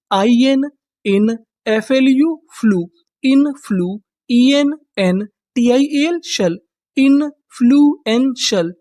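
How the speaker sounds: slow, syllable by syllable, letters then sounds; spelling it out by codes. Shown as a demonstration. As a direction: build the word piece by piece for students. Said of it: "teacher sounds out word by code".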